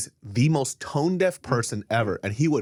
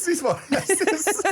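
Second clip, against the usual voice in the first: laughing; slower than usual. Acted. Mocking echo, laughing.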